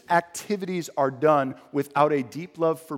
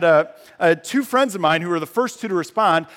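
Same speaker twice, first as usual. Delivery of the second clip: normal talking voice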